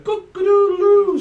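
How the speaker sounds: very high, loud crowing; cockerel crow. Pretend. Reader imitates a morning cockerel, crowing loudly. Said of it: "I'm also hearing cockerels as well".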